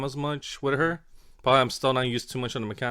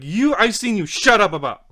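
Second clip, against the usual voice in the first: loud, higher-pitched retort; irritated shouting; 8 semitones higher, 9 dB louder than usual. Retold or imitated. Player telling off his brother for interrupting, irritated shouting. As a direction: snap back angrily over the top.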